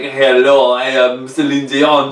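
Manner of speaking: loud, slow mock-French introduction; exaggerated French accent. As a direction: lay the French accent on thick, drawn out. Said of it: "but she's not like".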